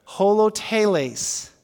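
holoteles slow and clear, ho-lo-te-les; teaching pronunciation. Shown as a demonstration. Pastor leading congregation to repeat the word, slow. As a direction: say holoteles slowly, syllable by syllable. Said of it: "say that one with me".

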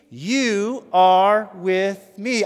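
loud, drawn-out words; forceful and very slow; rallying refrain. Shown as a demonstration. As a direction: lead the crowd in a slow, strong declaration. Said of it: "say it again with me".

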